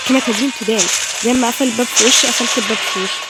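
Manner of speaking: loud, triumphant taunt; real mocking gloat. Real, not acted. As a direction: rub it in loudly. Gloating over the door.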